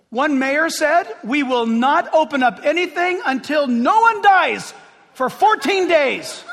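loud, high, shouting preacher; preacher rousing the church against fear; full-force preaching. Acted. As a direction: thunder it out from the pulpit.

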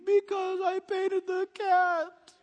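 put-on high, slow, sheepish kid; small child voice. Little Junior answering dad, a high slow sheepish kid voice. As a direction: play the guilty little boy confessing. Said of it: "Do you know why you're gonna get a spanking".

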